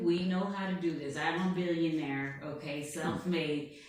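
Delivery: boastful blustering tycoon voice, put on all through; Donald Trump. President boasting to an interviewer, put-on blustering voice. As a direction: bluff and brag like a showman president. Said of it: "I'm Donald Trump".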